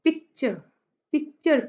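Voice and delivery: Picture with strong पिक, dropping to light चर; trochaic stress. Shown as a demonstration. Teacher gives trochee example, first syllable of picture stressed. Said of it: "पहला stress है".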